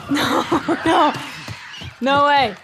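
alarmed disbelief. Real, not acted. Gamer facing an enemy that should be dead, alarmed shouting. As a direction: exclaim loudly in real panic. Disbelief as enemies keep coming.

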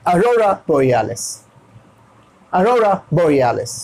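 "अरोरा बोरियालिस" with syllables run together as one name; joined together. Shown as a demonstration. Teacher puts the parts together, "अरोरा बोरियालिस" joined up. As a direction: link "अरोरा बोरियालिस" into a smooth phrase. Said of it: "तो साथ में होगा".

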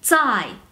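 "zai" as dz-ai with a falling tone; Mandarin ZAI. Shown as a demonstration. Teacher isolating the first syllable, "zai" with falling tone. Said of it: "se escribe Z A I".

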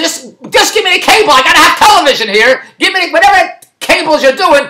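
high-pitched retold plea; exasperated pleading. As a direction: plead with the installer, voice climbing high. Retold pleading with the cable installer.

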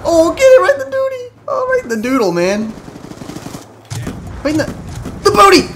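high, loud whooping taunt; high loud gleeful yelling at enemies. Real, not acted. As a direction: crow over the kill. Gleeful taunting while firing rockets.

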